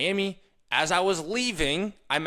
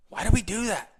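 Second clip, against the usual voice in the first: ordinary talk, nothing marked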